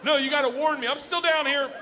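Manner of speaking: hammed-up complaint to the wife; mock outrage. Ranting at wife, high mock outrage.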